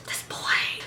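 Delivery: very soft, voiceless mock disbelief; exasperated whisper. Fan fed up with a misspelled name, exasperated whisper.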